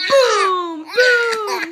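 loud booming boom; thunder boom. Acted. Reading to a child, booming like thunder. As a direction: roll it out like thunder. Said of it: "let's review".

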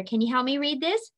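ordinary delivery

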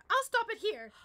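high, loud determined vow; loud, high declaration of defiance; defiant shout. Acted. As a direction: plant your feet and shout it. Claire stands her ground.

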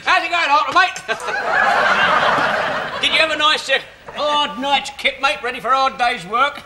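slap him on the back with your voice; cheery mate-to-mate ribbing, pushing the good cheer; overly hearty. Acted. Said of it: "Do you mind not being so hearty?".

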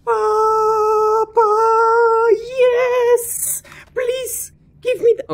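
very high, squealed excitement; thrilled squeal. Real, not acted. Player begging for the prize, thrilled squeal. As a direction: squeal and beg in delight. Thrilled at finding wanted item.

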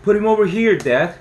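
put-on loud, high boy's shout; excited kid yelling to his dad; shouting child. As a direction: call it out over the noise. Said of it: "Toby shouted".

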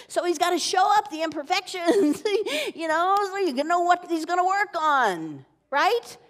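chuckling pulpit peak; preaching with a laugh. Real, not acted. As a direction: laugh while driving the point home.

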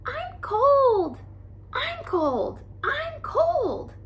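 put-on very high, squeaky cry of cold baby lemmings; squeaking lemming pups. A narrator's reading. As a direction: squeal it like tiny freezing pups. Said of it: "pups were squeaking loudly".

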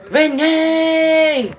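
long, high, loud call of a name; excited shout to a friend. Real, not acted. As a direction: sing out the name across the room. Calling out to a viewer.

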